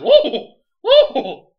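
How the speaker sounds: very high, loud whooping "whoa"; mock alarmed whoops; Ricardo. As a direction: shriek it as an exaggerated imitation. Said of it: "and Ricardo said".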